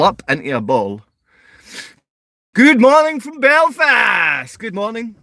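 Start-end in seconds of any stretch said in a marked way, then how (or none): 2.5-5.2 high, slow, drawn-out hello; a big stretched good morning to Belfast viewers; sing-song greeting. Real, not acted. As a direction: call it out like a breakfast host.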